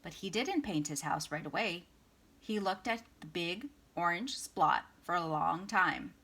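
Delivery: plain reading voice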